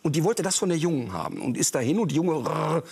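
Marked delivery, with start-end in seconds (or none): 2.5-2.8 rolled rrr growl; imitated dog growl. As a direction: growl like a dog guarding food. (nachgeahmtes Hundeknurren)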